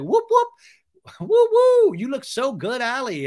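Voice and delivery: very high whooping gush; mock squealing hype; cheering. Acted Katie cheering Allie.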